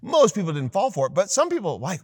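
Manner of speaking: normal talking voice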